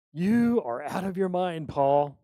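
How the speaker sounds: shouted. Retold or imitated. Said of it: "he shouted".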